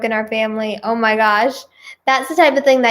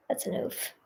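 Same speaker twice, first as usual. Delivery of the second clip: whispered aside; 17 dB quieter than usual. Real, not acted. Reacting to story, aside.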